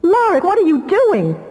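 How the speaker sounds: high, slow, frightened cry; a desperate high plea to her brother; panicked plea. Acted. Damia begging her brother to stop, panicked and high.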